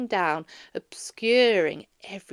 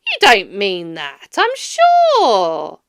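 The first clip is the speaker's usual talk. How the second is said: cheerful nephew; 12 dB louder, slower than usual. A narrator's reading. Nephew answering Scrooge.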